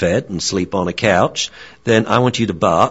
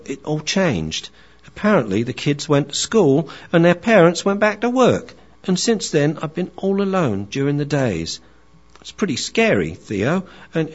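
higher, eager then forlorn puppy; young dog voice; 6 semitones higher than usual. Acted. Young dog tells Theo about being left alone, higher. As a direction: chatter high like a lonely young dog. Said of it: "he's dealing with his wise friend".

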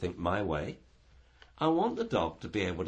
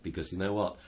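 ordinary talk

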